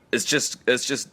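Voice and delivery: normal talking voice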